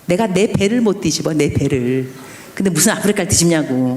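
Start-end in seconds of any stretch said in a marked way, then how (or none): none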